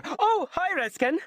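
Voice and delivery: high, bright burst of hello; light up with delight at seeing him; beaming greeting. A narrator's reading. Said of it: "Raylan beamed".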